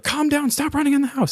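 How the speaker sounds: high, squeaky voice for the dog scolding the cats; a dog playing house monitor, high and squeaky; dog's voice. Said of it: "Mabel would bark and be like".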